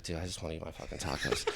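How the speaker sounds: very soft, low and quick, amused; small helpless aside, half laughing; meek muttered plea. Retold or imitated. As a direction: mumble it fast under a suppressed laugh. Meek inner plea beside yelling man.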